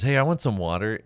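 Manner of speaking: calls out loud and clear; loud kid request. Retold or imitated. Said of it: "Fleety looks over at you and he goes".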